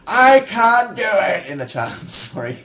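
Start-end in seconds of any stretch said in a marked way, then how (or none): 0.1-1.5 high, loud, drawn-out wail; mock frustrated yell. Chat failing to learn whistling, mock frustrated yell. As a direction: imitate a crowd wailing in failure. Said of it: "suddenly everyone's like".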